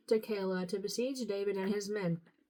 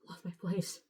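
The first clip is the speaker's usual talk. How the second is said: muttered aside; 4 semitones lower, 7 dB quieter than usual. Real, not acted. Reader mutters aside after losing place.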